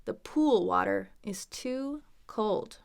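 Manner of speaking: "pool" drawn out slowly, every sound clear; slow the whole line to show "pool"; slow careful demo. Shown as a demonstration. Teacher slowing down so learners hear "pool". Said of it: "Ool is a tough sound too".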